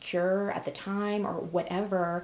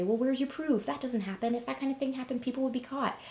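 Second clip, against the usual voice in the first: higher-pitched imitation of doubters; dismissive challenge in a raised pitch; skeptical objectors; 7 semitones higher than usual. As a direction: voice the naysayers, pitch lifted throughout. Voices doubters challenging her story.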